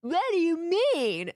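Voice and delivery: high, loud "What? What do you mean?"; mock confusion. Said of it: "people just were like".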